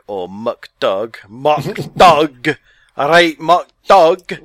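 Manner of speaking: exaggerated, bad Scottish accent, stretched out slow; terrible Scottish accent. Joking about a guest's name in a bad Scottish accent. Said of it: "That's my terrible Scottish accent there".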